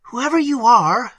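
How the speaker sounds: high, loud and afraid; trembling voice. A narrator's reading. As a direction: cry it out high in fear. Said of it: "said Emily in a trembling voice".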